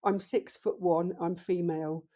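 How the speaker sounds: ordinary talk, nothing marked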